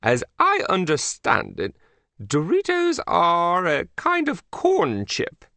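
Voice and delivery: high, swooping British royal voice; hammed-up British monarch; Queen of England. A narrator's reading. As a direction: puzzle grandly in a British accent. Queen muses about chips, summons boy.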